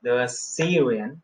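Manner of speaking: The Assyrian with stress on the syllable sy. Shown as a demonstration. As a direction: hit the sy of Assyrian. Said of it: "सी पे है". (Assyrian के सी पर ज़ोर)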